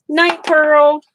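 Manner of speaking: high, loud call to Pearl; cheerful called goodnight; calling to pet. Real, not acted.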